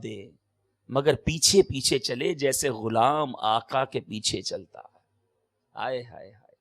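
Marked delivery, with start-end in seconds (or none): 5.7-6.5 admiring sigh. Real, not acted. Preacher marvels at the teaching.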